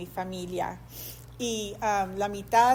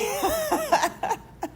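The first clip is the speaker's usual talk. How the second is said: joyful laughing shout. Retold or imitated. Risa de alegría tras la noticia. (carcajada fuerte y alegre)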